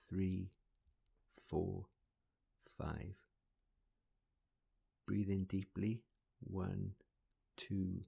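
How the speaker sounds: pace the breaths gently, counting slowly. Acted.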